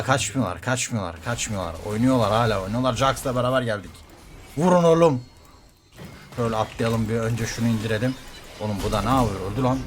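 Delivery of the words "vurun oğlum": shout to team. Real, not acted. Yelling at team.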